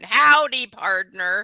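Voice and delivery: drawn-out Southern cowboy greeting; attempted Southern drawl. Shown as a demonstration. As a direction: demonstrate a clumsy Southern drawl. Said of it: "it was like mine".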